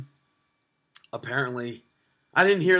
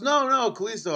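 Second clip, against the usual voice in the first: vendor voice; 8 semitones higher than usual. Retold or imitated. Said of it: "He goes".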